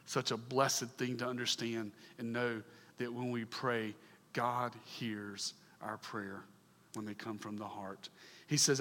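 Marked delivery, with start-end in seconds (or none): 4.3-6.4 GOD HEARS OUR PRAYER slow, low and soft; hushed reassurance. Real, not acted. Preacher on a blessed thing to know, slow soft emphasis on "God hears our prayer". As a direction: space out "God hears our prayer" quietly, letting each word settle.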